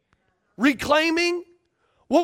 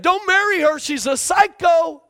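higher, louder mother's warning; mama warning; 5 semitones higher, 4 dB louder than usual. Retold or imitated. Preacher voicing mama warning her son. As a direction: shout the warning like a worried mother. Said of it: "Mama said".

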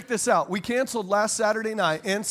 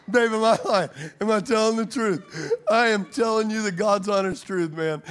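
usual speaking voice

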